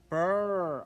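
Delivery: loud, high shivering brr. A narrator's reading. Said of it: "I'm getting cold".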